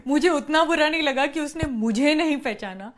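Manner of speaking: normal talking voice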